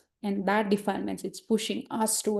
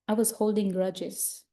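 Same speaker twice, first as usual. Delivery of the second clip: normal talking voice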